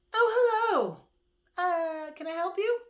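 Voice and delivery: a housewife opening the door; Missus Tortoise. Acted.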